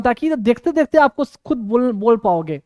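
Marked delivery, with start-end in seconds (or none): none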